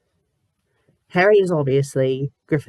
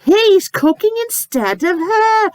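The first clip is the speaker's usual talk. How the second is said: high, loud, slow shriek of mock horror; mock outrage; 7 dB louder, slower than usual. Reviewer mocking the story's horror at a cooking man, mock outrage. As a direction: squeal it like a scandalised church lady.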